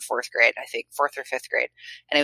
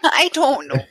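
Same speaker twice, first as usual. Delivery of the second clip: high, rounded Minnesota drawl on "I don't know"; Minnesota accent; 11 semitones higher, 5 dB louder than usual. Retold or imitated. Friend mimicking a Minnesotan, high Minnesota accent. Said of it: "Did he say it like that?".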